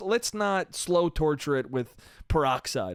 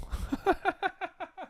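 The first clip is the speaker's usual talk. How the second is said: high laugh; 5 dB quieter than usual. Real, not acted. Laugh after punchline.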